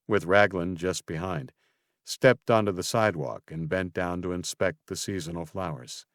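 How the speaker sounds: ordinary delivery, nothing marked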